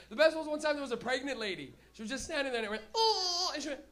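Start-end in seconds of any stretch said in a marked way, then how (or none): 2.9-3.5 put-on high loud wailing baby cry. Comic faking a baby's cry on the subway, high wail. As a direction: let out a baby's whimper.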